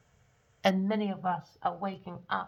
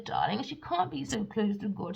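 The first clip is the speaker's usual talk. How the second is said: ordinary delivery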